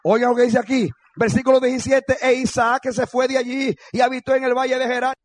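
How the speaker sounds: high, loud declaimed verse; preacher's ringing proclamation from the Bible; full-force scripture reading. Real, not acted. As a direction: cry the verse out over the congregation.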